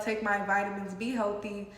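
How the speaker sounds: usual speaking voice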